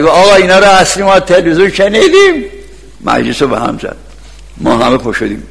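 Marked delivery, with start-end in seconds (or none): 0.0-2.4 raised, high-pitched heckle; mock yell at the preacher; retold shout. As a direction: imitate an angry interruption from the back. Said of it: "پشت داد زد".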